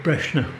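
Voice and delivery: Breshna with an e and sh, "BRESH-na"; older generation's pronunciation. Shown as a demonstration. Storyteller shows the elders' way, Breshna with a sh. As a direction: say Brosna as the old people do, Breshna. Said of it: "the older generation than me even pronounce it differently".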